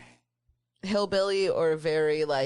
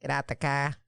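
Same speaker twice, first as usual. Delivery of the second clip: R-less Boston "car"; Boston accent; faster than usual. Acted. Enjoying a good Boston accent, playfully imitated. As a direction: put on a Boston accent, dropping R's. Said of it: "I like a good Boston accent".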